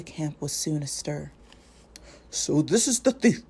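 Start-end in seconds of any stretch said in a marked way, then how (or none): 2.3-3.4 put-on a grave old wizard catching a thief; Gandalf's voice. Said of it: "said Gandalf".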